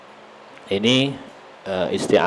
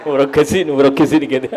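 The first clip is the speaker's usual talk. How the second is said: laughing; 7 semitones higher than usual. Real, not acted. Laughing through nervous remark.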